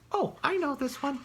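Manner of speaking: high, quick little squirrel voice. Acted. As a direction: chirp it out like an eager squirrel.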